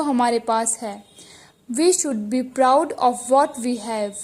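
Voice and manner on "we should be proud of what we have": slow dictation reading. Shown as a demonstration. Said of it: "teacher reads the sentence slowly".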